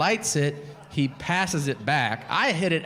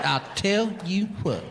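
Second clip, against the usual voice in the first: cowboy persona; 5 semitones higher than usual. Acted. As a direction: stretch each word like a mock cowboy. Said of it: "adopted the cowboy persona".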